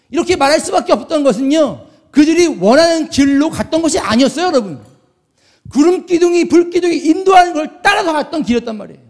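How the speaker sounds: heated, insistent preaching; worked-up anger. Real, not acted. Preacher defending where the pillars led them, worked-up anger. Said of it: "내가 또 왜 이렇게 화를 내고 있지?".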